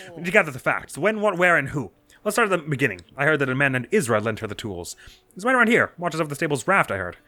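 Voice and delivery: high, upbeat bird reporter; chirpy newsman eager for a scoop; cheerful reporter voice. Acted. Voices Penn dialogue.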